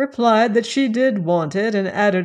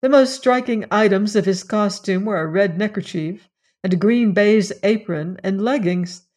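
ordinary delivery, nothing marked

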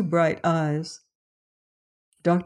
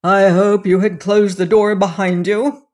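a louder, sharp snap; snapped; 3 semitones higher, 7 dB louder than usual. A narrator's reading. Saunderson scolding the servant over the door, snapping. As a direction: cut him with impatience. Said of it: "snapped Saunderson".

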